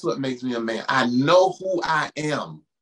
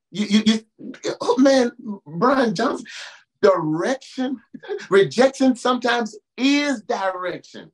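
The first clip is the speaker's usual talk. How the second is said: high, fired-up call with a laugh; excited preaching; 6 semitones higher than usual. Real, not acted. Preacher thrilled by a friend's saying, high excited call. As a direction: get happy about the word. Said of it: "preacher gets excited".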